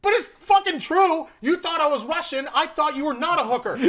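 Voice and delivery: retold shouting match, exasperated and high; pissed-off retort. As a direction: argue back in comic outrage. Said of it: "I'm pissed".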